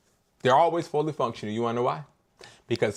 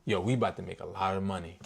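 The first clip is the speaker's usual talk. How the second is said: low confident line; 6 semitones lower, 6 dB quieter than usual. Shown as a demonstration. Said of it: "All I want you to say to them is".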